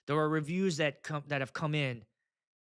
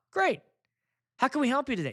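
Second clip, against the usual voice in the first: chirpy phone rep; 8 semitones higher, 4 dB louder, faster than usual. Retold or imitated. Phone representative.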